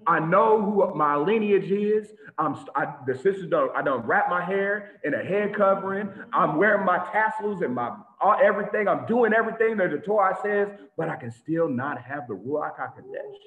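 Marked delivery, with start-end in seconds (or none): none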